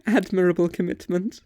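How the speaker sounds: high chuckle as she teases; waitress chuckling at a strange customer. Acted. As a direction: still laughing, mock praise. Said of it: "She's still fucking chuckling".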